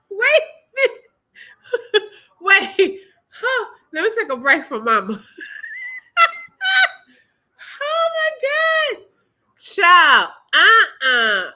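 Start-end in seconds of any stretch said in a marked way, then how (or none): 0.1-3.6 breaking into laughter mid-word; can't get the words out for laughing. Real, not acted. Reacting to mother's rant clip. 5.4-6.9 shrieking high laugh; a real squeal of laughter; high-pitched laugh. Real, not acted. Laughing at the clip. 7.8-11.5 shocked. Real, not acted. Shocked reaction to clip.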